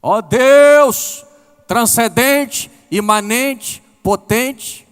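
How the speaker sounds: loud, high, ceremonious mock prayer; mock formal prayer. Woman praying a correct prayer without heart, declaimed loudly. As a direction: ham up a stiff, showy prayer to God. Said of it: "aquela oração mais teologicamente correta".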